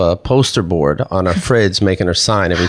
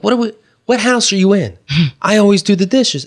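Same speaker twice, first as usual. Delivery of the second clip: incredulous retort; 10 semitones higher than usual. Retold or imitated. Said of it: "I'm like".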